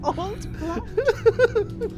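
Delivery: ordinary talk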